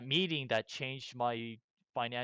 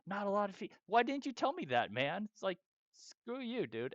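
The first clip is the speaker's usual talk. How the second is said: much higher, exasperated real rant; bitter rant; 8 semitones higher than usual. Real, not acted. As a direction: vent it at the advisor, high and fed up. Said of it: "I'm still bitter".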